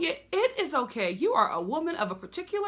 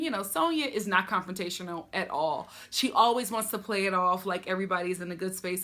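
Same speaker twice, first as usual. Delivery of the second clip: normal talking voice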